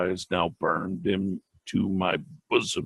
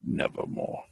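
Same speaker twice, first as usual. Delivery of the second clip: a soft, breathless whisper of the single word; raven's whisper; 9 dB quieter than usual. A narrator's reading. The raven answering the grieving man, whispered. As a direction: let it fall like a ghostly echo.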